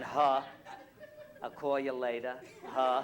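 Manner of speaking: loud, hammed-up Brooklyn accent; mock Brooklyn-accented goodbye. Shown as a demonstration. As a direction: show off broad Brooklyn speech. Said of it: "that's how you speak".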